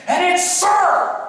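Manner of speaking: slow, high, loud declaration; imitated yelling. Drill instructor telling cadet his name, drawn-out yell. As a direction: draw it out as a loud order. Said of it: "he starts yelling at me".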